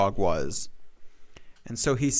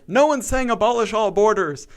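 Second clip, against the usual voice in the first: mocking liberals; 8 semitones higher, 5 dB louder than usual. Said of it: "and then liberals".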